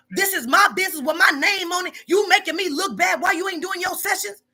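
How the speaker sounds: hammed-up chewing out an employee; mock angry boss. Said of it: "I don't come at you like that".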